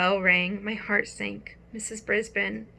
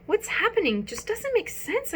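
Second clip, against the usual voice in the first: higher, puzzled squeak; bewildered hamster; 12 semitones higher than usual. Acted. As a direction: fret to the frog. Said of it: "I told Og".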